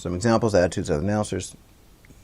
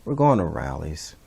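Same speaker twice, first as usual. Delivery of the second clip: low, downcast delivery; dejected, low-pitched resignation; gloomy; 6 semitones lower than usual. Shown as a demonstration. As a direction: say it glumly, pitch sunk. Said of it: "or gloomy".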